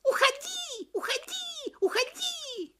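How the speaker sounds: very high, slow screeching monkey cry; shrill screeching bird woman. Acted. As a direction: shriek it at the tiger in terror. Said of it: "и закричала".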